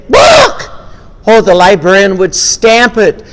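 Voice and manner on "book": high loud squawked word; chicken squawk. Acted. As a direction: squawk it out like a proud hen. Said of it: "the chicken would say".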